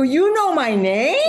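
very high, slow, laughing disbelief; amazed, laughing. Retold or imitated. When Tracy Brightman says her name, high laughing disbelief. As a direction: marvel that she knows you. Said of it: "I go".

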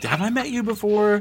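puzzled re-introduction. Retold or imitated. Meeting a bland guy again, mock puzzlement. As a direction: ask it like a forgetful acquaintance. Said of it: "him again and be like".

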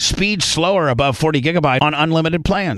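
loud, upbeat sponsor read; punchy host-read phone ad. Acted. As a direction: sell it like a radio spot. Reads a sponsor ad.

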